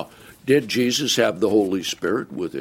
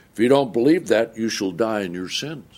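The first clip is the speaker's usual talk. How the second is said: ordinary delivery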